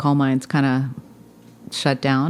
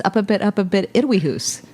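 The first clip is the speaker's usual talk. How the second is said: higher, light Scottish hoose; Scottish accent; 5 semitones higher than usual. Retold or imitated. Recalling how she misheard the rhyme as a child, in a light Scottish accent. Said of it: "I thought it was".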